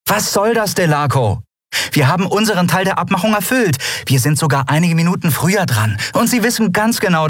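hohe Jungenstimme, schnell und erregt; hoch und hastig anklagen; Miko, aufgebracht. A narrator's reading. (Schiffsjunge stellt de Larco zur Rede, hoch und schnell)

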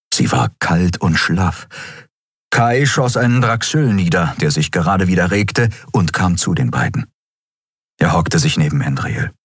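plain reading voice

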